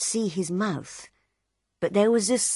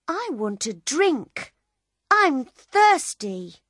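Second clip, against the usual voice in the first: put-on high, slow, whining child; whiny little boy; 8 semitones higher, 4 dB louder, slower than usual. A narrator's reading. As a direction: whine for a drink.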